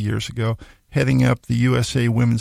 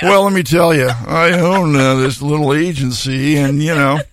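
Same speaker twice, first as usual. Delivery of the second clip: louder, puffed-up self-quote; mock boastful; 2 semitones higher, 6 dB louder than usual. Telling a stranger on a plane, in a mock boastful voice. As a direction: play up your own importance, half joking.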